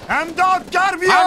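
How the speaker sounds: very high, full-force scream, drawn out; desperate call for help. Acted. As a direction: yell for a medic across a battlefield. Calling for a medic.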